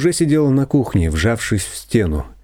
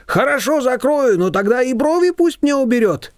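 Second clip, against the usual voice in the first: a hint of higher haggling voice; confectioner bargaining back; 14 semitones higher than usual. A narrator's reading. Confectioner haggling with Shushana, lightly voiced. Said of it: "тоже умела вести торги".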